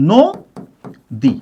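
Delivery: "নদী" pulled far apart, নো, long pause, দী; split into syllables. Shown as a demonstration. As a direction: say "নদী" as two isolated syllables. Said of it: "এর অক্ষর বিন্যাসটা কেমন". ("নদী" দুই অক্ষরে অনেকটা থেমে আলাদা করে)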